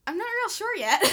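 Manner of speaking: high, loud nervous laugh through it; laughing off a painful question. Real, not acted.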